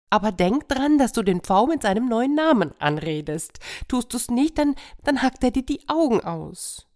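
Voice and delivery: high insistent hen voice; hen warning. Acted. Hen warning the cat, high and insistent.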